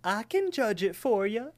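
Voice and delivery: high-pitched cartoon pie character; talking pie voice. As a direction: pipe up as an eager little talking pie. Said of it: "we could hear this voice saying".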